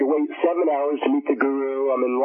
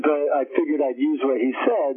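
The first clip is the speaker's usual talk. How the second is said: ordinary talk, nothing marked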